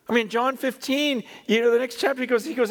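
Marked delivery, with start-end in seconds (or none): none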